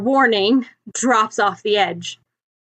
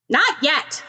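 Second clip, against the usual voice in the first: higher, short barked order; barking; 6 semitones higher than usual. Acted. Bakugo barking down at Ochako from the roof. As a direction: bark it down impatiently. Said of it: "Bakugo barks".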